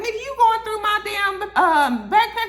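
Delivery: high, loud mock outrage; hammed-up indignant shout; indignant retort. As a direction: act offended at the snooping. [name] retold snapping at Fame.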